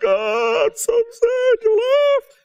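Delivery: high, slow, hammed-up wail; mock whining grief. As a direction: overdo the mourning for laughs. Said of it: "And we're like".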